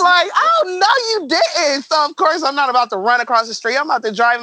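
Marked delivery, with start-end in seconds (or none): 0.3-1.8 retold outraged cry. Yelling at thief running off.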